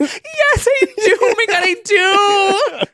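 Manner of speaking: very high, laughing fan squeal. Retold or imitated. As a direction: shriek like a starstruck fan. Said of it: "And you just hear".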